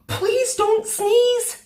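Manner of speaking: high, loud, slow pleading; urgent plea. A narrator's reading. Mum begging Sam as the flag tickles his nose. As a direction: beg him not to, drawn out.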